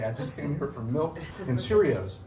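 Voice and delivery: ordinary talk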